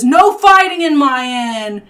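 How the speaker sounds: loud proprietor's order; shouting innkeeper. Acted. Proprietor telling the whole inn off, shouting. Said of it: "As the proprietor says".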